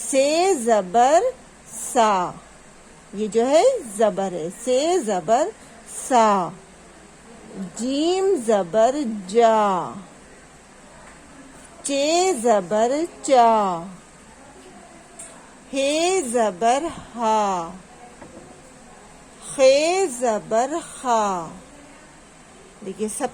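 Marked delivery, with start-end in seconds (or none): 0.1-2.3 slow, measured letter drill with long final vowels; drawn-out reading drill. Shown as a demonstration. Teacher showing children how to read, drawn out. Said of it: "اسی طرح سے آپ لوگوں کو پڑھنا ہے". 4.6-21.5 slow, measured letter drill with long final vowels; drawn-out reading drill. Shown as a demonstration. Teacher showing children how to read, drawn out. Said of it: "ہمیں تھوڑا سا کھینچ کے پڑھنا پڑ رہا ہے".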